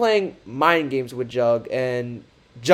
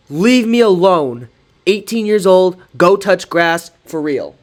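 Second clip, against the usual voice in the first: higher, louder and slower mock-dismissive brush-off; dismissive read; 4 semitones higher, 6 dB louder than usual. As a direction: read the text like you're waving him away. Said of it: "being more dismissive than".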